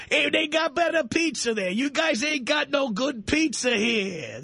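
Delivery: put-on high, loudmouthed New York accent; mock New Yorker. Host mocking New Yorkers bragging about pizza, high New York accent. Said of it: "you talk to New Yorkers".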